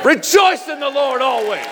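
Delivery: shouted, ringing call to rejoice; rallying shout. Real, not acted. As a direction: lift the room with a shout of joy. Preacher rallying the congregation.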